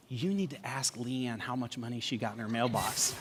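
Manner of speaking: low, very soft scheming voice; conspiratorial hush. Retold or imitated. Husband secretly sets his brother-in-law on his wife, hushed. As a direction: murmur it low, like sharing a plot.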